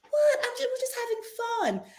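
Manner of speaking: high mocking defensive voice; playful high impression of the housewife; mocking Jessel. Jessel's defensive reply mimicked.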